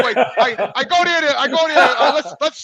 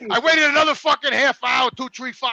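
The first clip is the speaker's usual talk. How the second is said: ordinary talk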